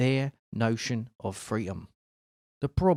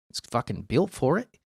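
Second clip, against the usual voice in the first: ordinary delivery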